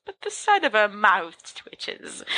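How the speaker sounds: ordinary delivery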